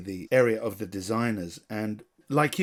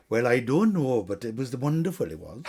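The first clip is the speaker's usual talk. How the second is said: put-on Swansea accent. Director imitates two women in saris overheard in Bangalore, Swansea accent. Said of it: "these two people walking behind me were from Swansea".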